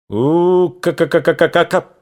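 high rattling ka-ka-ka burst; machine-gun imitation. Shown as a demonstration. Narrator imitates machine-gun fire.

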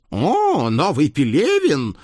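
high, conspiratorial talking horse. Acted. Horse voice, delighted.

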